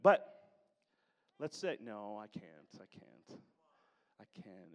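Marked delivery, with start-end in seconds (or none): 1.8-4.7 fading to a near whisper, playfully shy; mock shy refusal. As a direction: act out a bashful kid who won't share, trailing off.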